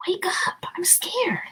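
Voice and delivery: frightened hush, no voice at all; scared whisper. Acted. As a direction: whisper urgently in the dark. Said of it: "he whispered".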